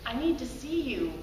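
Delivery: low, soft lover's voice; quiet, earnest and unhurried; calm insistence. Acted. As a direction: answer gently, falling in pitch.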